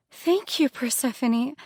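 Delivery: excited thanks. Acted. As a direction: burst out with gratitude. Grateful, then burst out.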